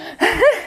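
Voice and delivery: a short, high laugh at her own fumble; amused laugh. Real, not acted. Coach laughing at holding her weight wrong, amused laugh. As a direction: laugh off the mistake.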